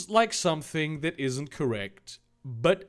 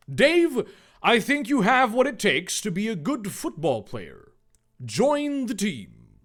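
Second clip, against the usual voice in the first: generic American voice; 6 semitones higher, 4 dB louder than usual. Acted. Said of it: "I'm gonna give him this voice. The generic American voice".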